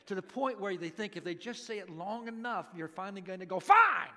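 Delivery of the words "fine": loud, high burst of surrender; exasperated giving in. Acted. Parent worn down by endless begging, exasperated shout.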